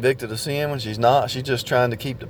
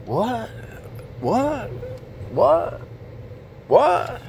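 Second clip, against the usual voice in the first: each what jumps much higher in mock disbelief; stunned what; 9 semitones higher, 5 dB quieter, much slower than usual. Preacher acting out the shock of waking up, stunned and much higher. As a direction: act out a dazed double take.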